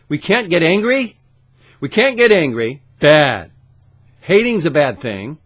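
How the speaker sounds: loud, high mocking of the rules; sarcastic mockery. Mocking society's rules to viewers, loud and sarcastic. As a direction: sneer out the rules you're given.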